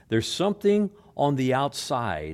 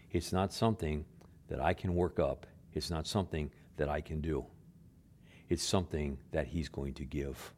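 much softer, hushed inner voice; 8 semitones lower, 10 dB quieter than usual. Retold or imitated. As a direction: let it sink to a near-hush, like a voice inside. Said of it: "something in a voice that says".

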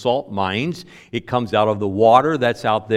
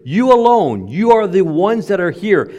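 high, loud stress on "You alone"; emphatic statement; 5 semitones higher, 5 dB louder than usual. Retold or imitated. Preacher voices Jesus singling out his disciples, emphatic. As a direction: drive "You alone" home, raised and forceful. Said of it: "with an emphatic statement here".

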